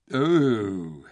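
drawn-out groan of distaste; disgusted. A narrator's reading. Lucy breaks off her story, disgusted. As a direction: recoil from the memory. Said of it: "Lucy made a face".

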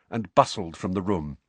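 ordinary delivery

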